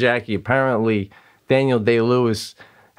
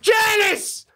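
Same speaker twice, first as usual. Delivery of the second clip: very high, frantic yell across the house; excited shouting. Acted. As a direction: call out to your wife, thrilled and stunned. Acting excited viewer calling his wife.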